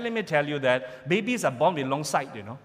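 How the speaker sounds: usual speaking voice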